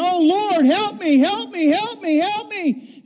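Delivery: very high, desperate repeated pleas; mock-terrified roofer calling for help; frantic screaming. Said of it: "and he's screaming".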